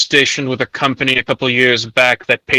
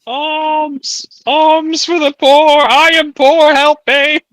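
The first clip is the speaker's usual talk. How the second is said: very high, loud, drawn-out beggar's cry; begging for alms; 5 dB louder, much slower than usual. Pretend. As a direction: wail like a hammed-up street beggar. Plays begging flagellant crying for alms.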